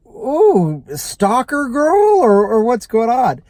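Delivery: mock-alarmed thought about a stalker; alarmed surprise. Driver's alarmed inner thought.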